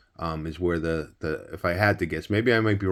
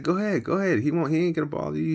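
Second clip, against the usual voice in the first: higher, quick and lightly voiced, pushing the kid on; coaxing father; 7 semitones higher, much faster than usual. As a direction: nudge your son into the cell.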